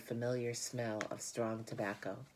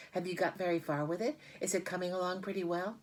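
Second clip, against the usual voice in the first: anxious eagerness; 6 semitones higher, faster than usual. A narrator's reading. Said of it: "Wilbur was trembling again".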